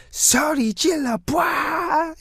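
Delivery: very high, drawn-out mock stage yell; performer hyping crowd. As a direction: call out to a Korean crowd, held back in volume. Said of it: "You gotta be like".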